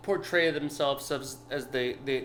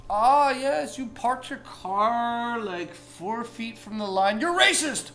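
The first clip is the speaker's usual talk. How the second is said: mock scold; 6 semitones higher, 4 dB louder, slower than usual. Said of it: "All that bullshit about".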